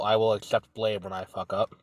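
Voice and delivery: ordinary talk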